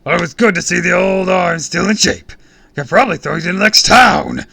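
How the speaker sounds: loud, high blustering shout; booming boast. A narrator's reading. Villain bragging about his throwing arm, booming. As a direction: brag at full volume.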